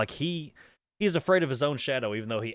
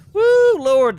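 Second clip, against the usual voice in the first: very high, loud whooping release; shaken loud exclaim; 14 dB louder than usual. Real, not acted. Streamer recovering from a scare, shouting. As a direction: blow off the fright at full voice.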